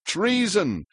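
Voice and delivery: usual speaking voice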